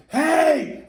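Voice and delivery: high, loud startled shout; panicked yell. Retold or imitated. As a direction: yell out as if suddenly threatened. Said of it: "Like he's yelling out".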